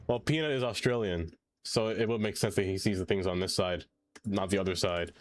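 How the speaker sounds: ordinary talk, nothing marked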